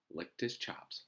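plain reading voice

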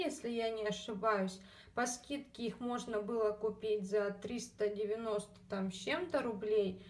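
usual speaking voice

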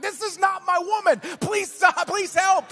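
high, loud cry for help; re-enacted shout for rescue; shouted plea. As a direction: plead loudly with onlookers to step in. Acted plea to bystanders for help.

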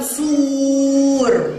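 "سور" with the ū stretched long; strong long damma. Shown as a demonstration. Teacher gives example of madd, vowel drawn out. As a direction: draw out sūūūr. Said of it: "ينطق ضمة شديدة".

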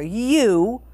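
storybook king's scornful question; spat with scorn. A narrator's reading. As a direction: challenge her, stretching the question. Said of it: "The king spat at her".

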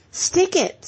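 high-pitched urging call; encouraging. A narrator's reading. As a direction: cheer a runner on, pitched well up. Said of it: "said Ginger encouragingly".

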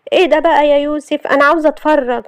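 high, whiny protest; complaining girls. Acted. Girls wanting the series, appealing to grandmother, whiny and high. Whining characters argue over TV.

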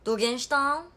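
loud, punchy Hakata dialect; forceful demo of heavy Hakata dialect; thick Hakata dialect. Shown as a demonstration. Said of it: "どぎつい博多弁喋って".

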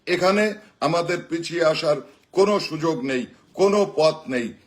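rousing political rally speech; rally the crowd, building to shouts; roaring rallying speech. Acted.

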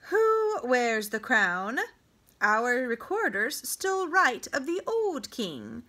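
lightly voiced king, loud and sneering; scornful king. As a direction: mangle the names with disdain. King lists Cushite kings.

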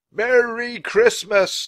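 high, loud, long-drawn holiday cry; jubilant shout. A narrator's reading. Scrooge calls out to the world, jubilant shout. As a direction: holler it slowly to everyone. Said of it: "As Scrooge was shouting".

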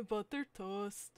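lightly voiced cartoon character, words drawn out. Shown as a demonstration. Trying a cartoon voice for a character, slow and soft. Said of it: "Should I make him sound like Ed from Ed, Edd n Eddy".

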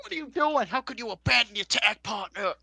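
high, loud mock outrage; retold yell. Daivari berating his tag partner for abandoning him, mock yelling. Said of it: "Ari Daivari yells at him".

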